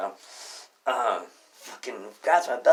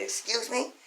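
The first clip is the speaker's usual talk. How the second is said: high, rising incredulous question; retold indignant challenge; 12 semitones higher than usual. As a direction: query it high, in retold disbelief. Retold indignant reply to customer.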